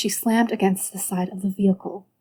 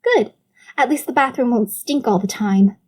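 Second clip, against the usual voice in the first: snapping back; 3 semitones higher, 4 dB louder than usual. Acted. Sister snaps back.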